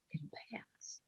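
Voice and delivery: breathy whisper of the recalled words; hushed, as if hearing it again inside. Retold or imitated. A man recalling her words, whispered under his breath.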